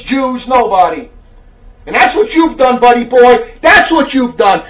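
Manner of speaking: loud, forceful rebuke with hard-hit words; fiery preaching. Real, not acted. Preacher scolding a letter writer over race and faith, fiery rebuke. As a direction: preach it hard at the wayward listener.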